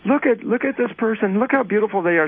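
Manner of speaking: high-pitched mock admiration; admiring onlookers. Onlookers admire someone in love.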